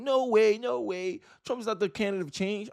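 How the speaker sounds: high-pitched mocking protest; high mock-indignant voice of an opponent; mocked leftist objector. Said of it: "I had a leftist in my commentary saying".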